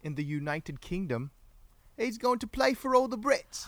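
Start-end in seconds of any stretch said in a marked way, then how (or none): 2.0-3.7 put-on high-pitched British accent; mock-British lilt. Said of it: "I do like the British accent".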